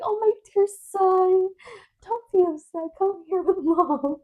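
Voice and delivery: high, sugary mommy voice; high-pitched sweet pleading; coaxing mother. Retold or imitated. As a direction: coax an upset little child, hammed-up. Coaxing upset son, retold.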